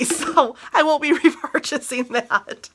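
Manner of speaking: real amused laugh breaking into the talk. Real, not acted. Reviewer writes off a too-strong cleaner to viewers, laughing. Laughs while dismissing the product.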